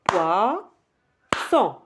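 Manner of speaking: POISSON broken into poi... son; syllable by syllable. Shown as a demonstration. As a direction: leave a clear gap inside poisson: poi, son. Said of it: "on va taper".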